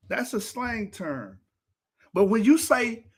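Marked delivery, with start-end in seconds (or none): none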